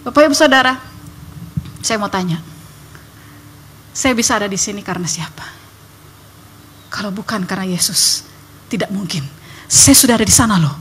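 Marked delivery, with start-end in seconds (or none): none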